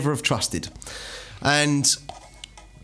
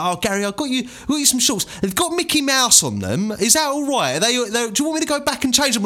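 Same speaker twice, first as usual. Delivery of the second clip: stepdad calling; 10 semitones higher than usual. Retold or imitated. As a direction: lightly mimic the cheery stepdad calling in. Stepdad voiced in story.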